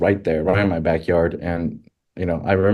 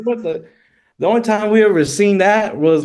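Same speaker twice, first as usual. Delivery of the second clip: stunned disbelief; 13 semitones higher, 5 dB louder than usual. Retold or imitated. Retold shock continues.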